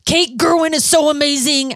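high excited gush; mock fangirl gushing; gushing quote. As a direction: squeal it like a thrilled fan. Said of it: "you came back with".

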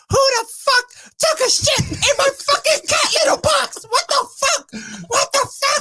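very high, slow mock wail; bawling outrage. Woman finding mess in litter box, hammed-up bawling outrage. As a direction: imitate a woman shrieking through tears. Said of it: "fucking bawling her eyes out, crying".

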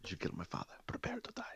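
whispered vengeful vow; whispering. Retold or imitated. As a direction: breathe the threat as a whisper. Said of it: "Princess Bride, dude".

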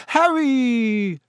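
high, drawn-out theatrical hail; mock-pompous greeting. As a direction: greet him like a hammy toff. Fred hams up a pompous greeting.

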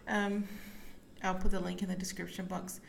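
ordinary talk, nothing marked

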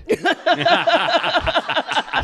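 a high, real laugh at her own joke; high burst of laughter. Real, not acted. Playwright laughing at her joke about Mamet, real high laughter.

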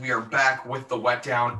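ordinary delivery

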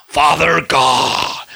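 slow, drawn-out mock-pious prayer; put-on churchy address to God; religious praying voice. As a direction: intone it with exaggerated piety. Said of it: "We get religious, don't we".

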